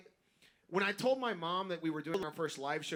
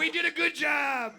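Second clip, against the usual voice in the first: much higher, louder laughing cheer; hammed-up proud dad yelling with laughter; proud dad shout; 10 semitones higher, 8 dB louder than usual. Imagining dad's proud reaction.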